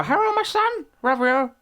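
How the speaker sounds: very high mock Chinese accent. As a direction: gush like the shop lady, very high. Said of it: "She didn't, like, go".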